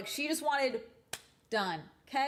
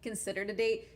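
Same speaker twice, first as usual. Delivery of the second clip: ordinary talk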